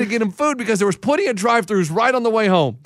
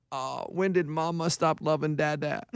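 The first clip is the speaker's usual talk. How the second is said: put-on low, soft drunken mumble, much softer; drunk husband whine; 4 semitones lower, 11 dB quieter than usual. Drunk Ray whining to his wife.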